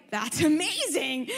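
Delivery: high, drawn-out mock wonder; imitated amazement. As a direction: gush slowly like an amazed listener. Acts out an amazed listener.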